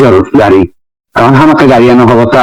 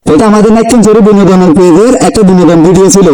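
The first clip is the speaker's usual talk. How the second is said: raised, standard Bengali sign-off to viewers; outro address; 6 semitones higher than usual. Real, not acted. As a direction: speak up to the audience, standard Bengali. Closing message to viewers.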